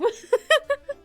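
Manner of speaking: high villain cackle; evil laugh. Acted. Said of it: "very glad that I was able to do an evil laugh".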